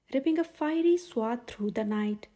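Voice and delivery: plain reading voice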